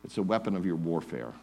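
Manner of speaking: usual speaking voice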